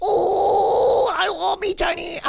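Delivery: very high, exaggerated Chinese accent; absurdly high-pitched fake Chinese accent; mock Chinese accent. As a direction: shriek out a caricatured Chinese accent. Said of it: "I'm doing a Chinese accent".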